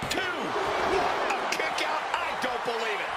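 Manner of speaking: stunned slow call of the kickout; disbelief. Acted. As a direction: drag out the kick out in shock. Shocking kick out called.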